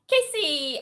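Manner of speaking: sudden loud shout of a name; loud call. Real, not acted. Streamer greeting viewer Casey with a loud shout. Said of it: "that was loud".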